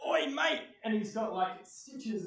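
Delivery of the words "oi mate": loud, high orc hailing you; hammed-up orc calling out to the player; orc greeting. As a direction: bellow a cheeky hello as the orc. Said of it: "that orc comes up and says".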